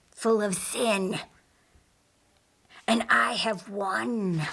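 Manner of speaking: hushed, slow sinister whisper, gloating at the end; a fallen angel whispering his suspicions to himself; scheming demon whisper. Acted.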